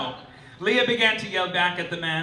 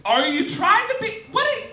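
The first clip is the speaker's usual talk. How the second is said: high, incredulous comic rant; exasperated disbelief; 4 dB louder than usual. Acted. Comic host ranting at The Advocate, high and incredulous. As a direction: pitch way up, baffled and demanding answers.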